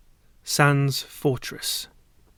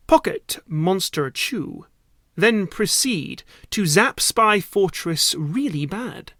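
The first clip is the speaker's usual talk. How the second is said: ordinary delivery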